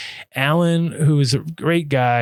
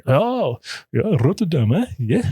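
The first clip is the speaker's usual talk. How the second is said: higher teasing jeer with a laugh; mocking Amsterdammers; 5 semitones higher, slower than usual. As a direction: needle him about his Rotterdam accent. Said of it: "they're gonna get on your".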